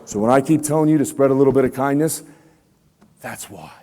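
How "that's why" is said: hushed whisper on the punchline; whispered payoff to the students. Real, not acted. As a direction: drop to a whisper to drive it home. Quiet punchline after the habit question.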